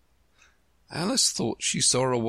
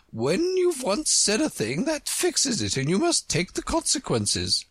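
high-pitched queen laying down rules; stern Red Queen. Acted. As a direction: lecture the pupil in a very high, commanding voice.

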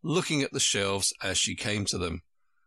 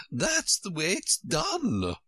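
high, slow, decisive Queen; high-pitched, emphatic certainty; great decision; 8 semitones higher, slower than usual. A narrator's reading. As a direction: declare it firmly, drawing it out. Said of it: "said with great decision".

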